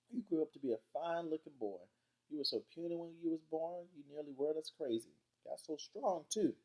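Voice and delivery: high-pitched Southern granny, full of praise; admiring grandma. Acted. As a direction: fuss over a grown grandchild as granny. Grandma Sands admires Byron.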